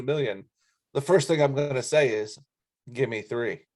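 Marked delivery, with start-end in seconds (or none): none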